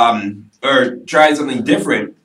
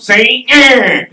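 high, loud made-up politician voice; Donald Trump; 9 semitones higher, 6 dB louder than usual. Acted. Guest answers host's impression with his own, high loud caricature. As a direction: push it up into a loud cartoon politician. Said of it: "make up my own voice called Donald Trump".

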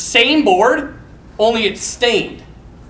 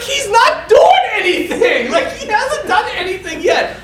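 laughing disbelief; 10 semitones higher than usual. Retold or imitated. Pointing out the idle worker.

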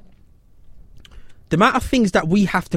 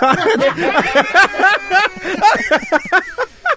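high, loud laughing fit; loud burst of laughter; 13 semitones higher, 3 dB louder than usual. Real, not acted. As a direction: crack up at the mishearing.

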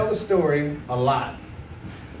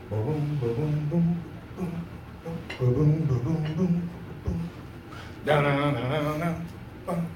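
mouth riff; 6 dB quieter than usual. Pretend. Mimicking Whole Lotta Love riff.

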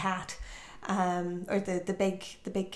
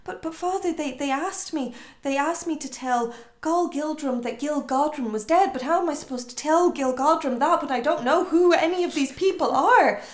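confused James; 6 semitones higher, 6 dB louder than usual. Retold or imitated.

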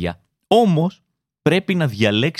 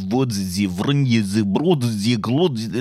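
mock Russian gibberish; 4 semitones lower, slower than usual. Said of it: "Εσύ ακούς".

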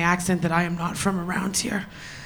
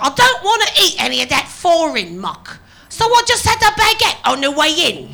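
put-on loud, high-pitched caricature of a British accent; hammed-up British accent, climbing higher; mock British regional accent; 7 semitones higher, 5 dB louder than usual. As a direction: imitate a British contestant's accent, loudly. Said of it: "Anytime I hear that accent".

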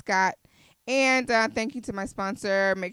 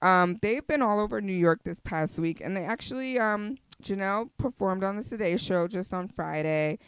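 normal talking voice

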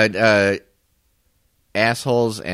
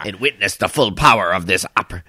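weighty commanding emperor. Acted. Continues Emperor impression.

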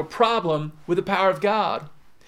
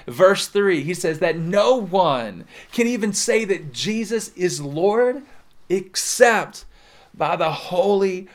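ordinary delivery

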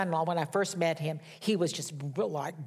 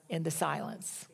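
"in the silence" dropped much softer, falling; hushed; 3 semitones lower, 7 dB quieter than usual. Real, not acted. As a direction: let "in the silence" fade almost to nothing.

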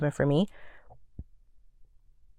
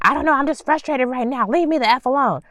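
higher voice, imitating someone snapping in frustration; mock frustrated yell; 9 semitones higher than usual. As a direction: play the fed-up yeller, pitched up. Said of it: "who just start yelling at people".

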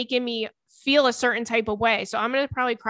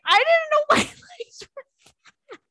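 high, louder, breaking into laughter; laughing through it; 3 dB louder than usual. Retold or imitated. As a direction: retell it laughing helplessly. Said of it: "And I started hysterical laughing".

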